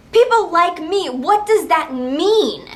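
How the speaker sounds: what does that mean, offended; indignant. Real, not acted. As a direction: throw the insult back, stung. Offended by clerk's remark.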